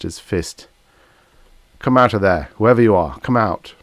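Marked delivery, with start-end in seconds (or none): 1.8-3.7 loud, forceful order; commanding call. Acted. Captain ordering whoever hides inside the wub out, loud command. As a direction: call out firmly to someone hidden. Captain challenging a hidden speaker.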